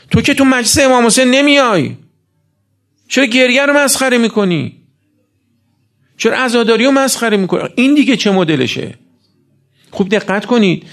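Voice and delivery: high, forceful rebuke from the pulpit; impassioned preaching. Acted. Preacher confronting a man who mocks mourning and prayer, indignant rebuke.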